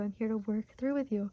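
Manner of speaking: ordinary talk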